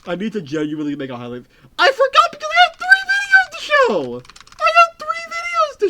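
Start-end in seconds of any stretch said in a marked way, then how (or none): 1.8-5.9 very high, loud frantic squeal; shrieking falsetto. Real, not acted. Streamer realizing forgotten videos, shrill high squeal.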